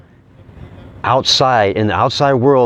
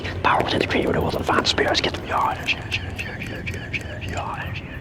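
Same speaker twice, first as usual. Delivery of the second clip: soft, breathless whisper of self-affirmations; hushed chant calling in energy; 6 semitones lower, 7 dB quieter, much faster than usual. Acted. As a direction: murmur each mantra like a private prayer.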